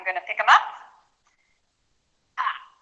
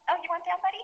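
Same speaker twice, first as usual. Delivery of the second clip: talking to the dog; 9 semitones higher, 6 dB quieter, faster than usual. Real, not acted. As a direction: coo it gently to your little dog.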